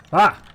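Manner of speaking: sudden high, loud yelp; startled yell. Real, not acted. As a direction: yelp at a jump scare you saw coming. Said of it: "it still made me yell".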